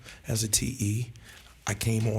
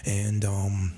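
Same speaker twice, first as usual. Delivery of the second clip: ordinary talk, nothing marked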